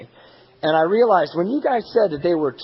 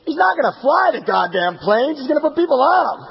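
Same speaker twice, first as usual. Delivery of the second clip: high, raised-voice retort; exasperated outburst; 9 semitones higher, 4 dB louder than usual. Retold or imitated. Airline executive overruling human resources, high exasperated shout. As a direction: call it out high, imitating an exasperated boss.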